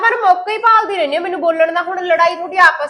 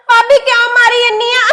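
higher, louder sing-song fake wail; 7 semitones higher, 8 dB louder than usual. Girl fakes a wail to frame her sister-in-law, hammed-up crying. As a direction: wail like she was slapped, putting it on. Fakes being hit, wails.

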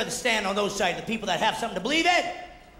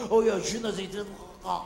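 a light mock French accent, made-up sounds. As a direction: babble fake French, lightly. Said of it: "make up the pronunciation".

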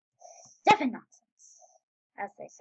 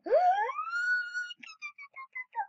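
a sudden high breathy gasp of shock; sharp gasp. Retold or imitated. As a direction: gasp as if caught off guard. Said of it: "I was like".

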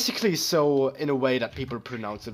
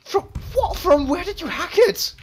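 baffled; 12 semitones higher than usual. Real, not acted. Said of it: "I was just super confused".

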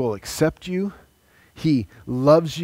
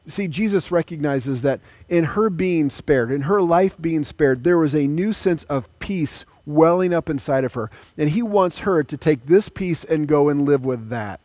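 normal talking voice

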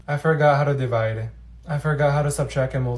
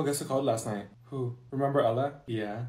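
usual speaking voice

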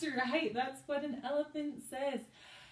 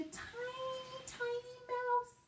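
tiny mouse voice; 3 dB quieter, slower than usual. Shown as a demonstration. Teacher playing tiny mouse.

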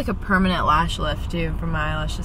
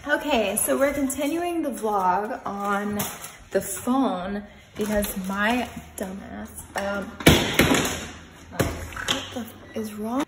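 quiet; 9 dB quieter than usual. Real, not acted. Quiet grumbling aside.

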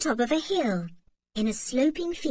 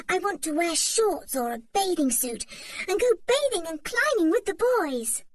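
put-on light, high girlish voice, full of longing; a little girl dreaming of summer; eager young girl; 7 semitones higher than usual. A narrator's reading. Anne tells Mother she's tired of tunics, eager girl.

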